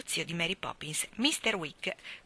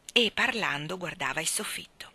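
usual speaking voice

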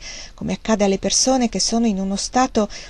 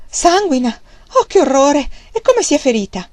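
high, loud shocked questions; acted high-pitched alarm; horrified; 7 semitones higher, 5 dB louder than usual. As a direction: cry out in squeamish horror. Fanny horrified at news of blood.

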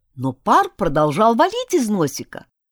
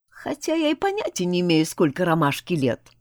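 ordinary delivery, nothing marked

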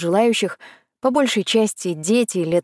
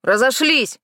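yelling; 5 semitones higher, slower than usual. Acted. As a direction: shout the crowd away. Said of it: "и наорал".

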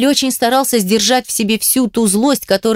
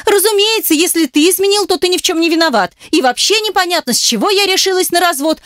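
high-pitched sarcastic tirade; hysterical sarcasm; 9 semitones higher than usual. A narrator's reading. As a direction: rant on, pitched way up.

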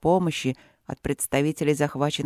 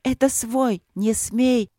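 higher, fearful plea; scared she can't hold the spirit back; frightened cry; 6 semitones higher, slower than usual. Acted. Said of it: "В голосе её был страх".

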